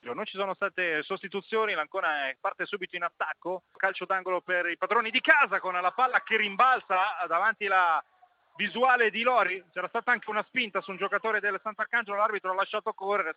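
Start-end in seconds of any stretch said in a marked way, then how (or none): none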